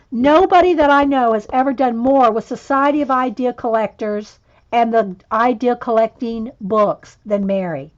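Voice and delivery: usual speaking voice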